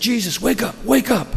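high, insistent cry to wake; acted panic, pitched well up; urgent waking call. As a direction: rouse a sleeper in alarm. Voices disciples waking Jesus in storm.

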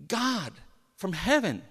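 ordinary talk, nothing marked

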